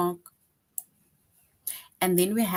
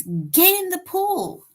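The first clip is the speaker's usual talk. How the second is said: much higher and louder, slow, ordering; bossy command; 12 semitones higher, 5 dB louder, slower than usual. Shown as a demonstration. Teacher acting out the command option, high and loud. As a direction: bark it like a parent at the pool. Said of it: "Option three says".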